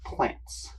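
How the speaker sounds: hushed whisper of a word. Real, not acted. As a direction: whisper it like a secret. Whispered reveal.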